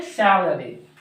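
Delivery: सैलरी in three syllables, falling low; teacher's careful "sa-la-ree" for सैलरी; corrected pronunciation. Shown as a demonstration. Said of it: "सैलरी नहीं, सैलरी होता है".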